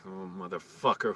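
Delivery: usual speaking voice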